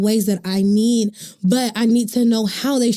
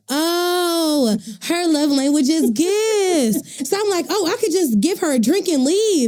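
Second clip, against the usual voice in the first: realizing aloud; 8 semitones higher than usual. Retold or imitated. Telling friends how I realized, higher dawning voice. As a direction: voice rising high as it clicks for me. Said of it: "I was like".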